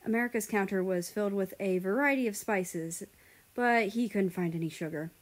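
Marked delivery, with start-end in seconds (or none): none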